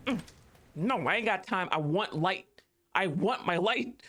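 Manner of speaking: ordinary delivery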